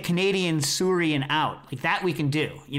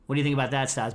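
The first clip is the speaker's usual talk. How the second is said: ordinary talk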